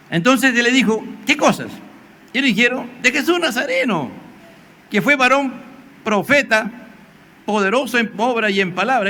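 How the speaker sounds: loud and high, preaching at full force; fiery preaching. Acted. Preacher retells the disciples' answer, shouting it out. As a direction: proclaim it to the congregation.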